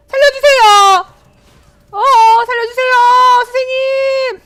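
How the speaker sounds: high, loud hammed-up cry for help; mock panicked plea. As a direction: beg the teacher to save you, playfully. 게임 중 쫓기며 애원. (장난스럽게 겁에 질려 높고 크게 살려 달라고 외침)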